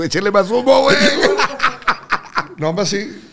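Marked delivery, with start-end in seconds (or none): 0.0-1.0 louder and higher, a joking yell; mock shouted order. Telling the crew to pour on smoke, mock shouted. 1.0-2.5 high, loud laugh; burst of laughter. Real, not acted. Laughing at his own smoke story, loud high laugh.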